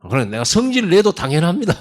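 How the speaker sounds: defiant talking back. Retold or imitated. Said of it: "하면서 하나님 앞에 대들어요".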